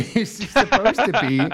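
high, loud laughing speech. Real, not acted. Laughing at the clumsy description, loud and high. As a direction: talk through a burst of laughter. Breaks into laughter while explaining.

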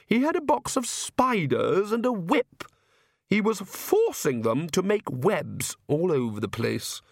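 high aristocratic lady voice, complaining; high-voiced, exasperated Countess; Countess, irritated. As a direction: raise the pitch for an indignant wife. Narrator plays the Countess.

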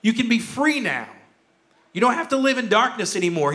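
urgent call to leave now. Retold or imitated. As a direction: urge her out the door right now. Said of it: "There's a sense of urgency to this".

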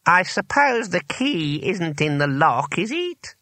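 slow, high, drawn-out query; slow wizard voice rising at the end; trapped master wizard. A narrator's reading. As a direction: fish for help with stiff dignity. Trapped wizard asks through door.

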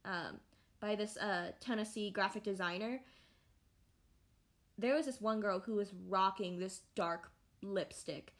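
usual speaking voice

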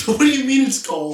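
high, loud, laughing question; laughing disbelief. Real, not acted. Friend reacting to sprayed butane, laughing in disbelief. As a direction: ask it incredulously through laughter.

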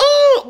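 very high, loud "oh"; mock pained cry. Playing the bear hit in the nuts, high mock yelp. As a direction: squeal it like a blow to the groin.

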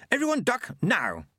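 high-pitched cry to take cover; urgent warning. Acted. Monk warning before the blast, urgent. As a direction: warn them urgently, dropping on the last word.

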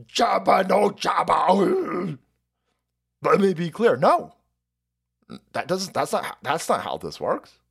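ordinary talk, nothing marked

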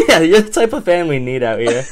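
loud, high, laughing through the words. Real, not acted. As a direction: play along while cracking up. Both cracking up over the bit.

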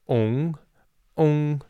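"ong" with a rounded O sound in place of eng; "ong" the way it is said in Taiwan; southern ong ending. Shown as a demonstration. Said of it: "more of an O sound".

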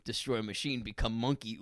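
ordinary talk

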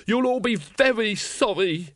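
high, slow, venomous threat; hissed threat. A narrator's reading. Cornered secretary threatens the watchmen, hissing. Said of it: "he hissed".

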